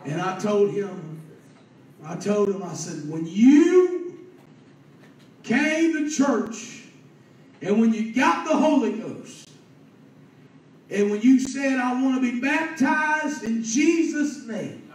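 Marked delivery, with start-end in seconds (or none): 3.1-14.8 high, loud, stretched-out exhortation; impassioned preaching. Real, not acted. Preacher reminds a man of his baptism, impassioned. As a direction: hold the key words long and ring them out.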